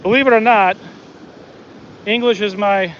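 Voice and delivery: ordinary delivery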